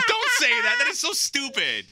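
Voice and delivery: high, slow scolding wife voice; squeaky scolding. Retold or imitated. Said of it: "She said".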